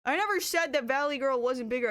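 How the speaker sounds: ordinary talk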